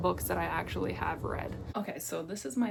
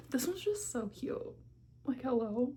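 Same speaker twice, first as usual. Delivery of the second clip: gushing; 5 semitones higher than usual. Real, not acted. Gushing over cute photo.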